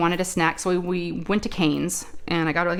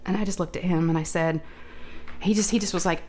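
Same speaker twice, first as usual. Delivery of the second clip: ordinary talk, nothing marked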